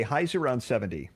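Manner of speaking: ordinary delivery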